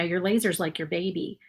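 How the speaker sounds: usual speaking voice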